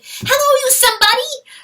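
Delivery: high loud eager hello; excited greeting call. Acted. Weasel greeting the stag, high and excited.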